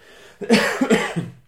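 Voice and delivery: a loud, rough cough; chesty cough. Real, not acted. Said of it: "scusate la tosse".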